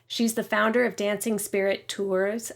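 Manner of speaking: usual speaking voice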